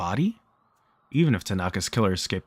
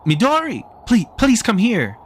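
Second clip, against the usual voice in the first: desperate shout; 13 semitones higher, 8 dB louder than usual. A narrator's reading. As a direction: call out desperately for help. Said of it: "she shouted".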